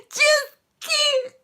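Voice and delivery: ordinary talk, nothing marked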